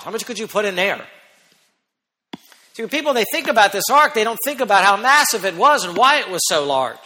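usual speaking voice

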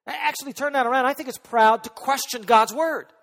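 high, loud pulpit rebuttal; forceful preaching. Real, not acted. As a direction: turn the charge around with full force.

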